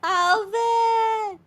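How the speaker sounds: high, squeaky voice-changer chipmunk. Real, not acted. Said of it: "you sound like a chipmunk".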